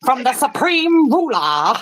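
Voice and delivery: slow, high, loud mock-grand voice; imitated speaker. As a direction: proclaim it slowly in a grand impression. Continues the impression.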